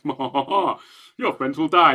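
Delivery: lightly hammed-up cartoon villain; gloating like a cartoon baddie; mustache twirling villain. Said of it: "mustache-twirling villain".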